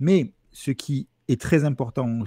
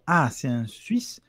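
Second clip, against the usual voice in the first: slow, drawn-out Swiss French cliché; slow Swiss Romand lilt, stretched phrase endings; Swiss accent; 2 semitones higher, slower than usual. Shown as a demonstration. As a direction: caricature a gentle Swiss mountain dweller's accent, taking your time. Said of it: "il parle doucement comme ça".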